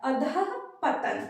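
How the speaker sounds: अधःपतन drawn out slowly with the breathy visarga before पतन; slow correct pronunciation. Shown as a demonstration. Teacher slowly models the correct word for students. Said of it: "लेकिन ये अधोपतन नहीं".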